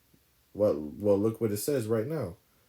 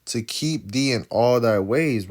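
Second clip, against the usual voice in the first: slow scripture reading; 5 dB louder, slower than usual. Acted. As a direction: read the verse slowly and deliberately, word by word.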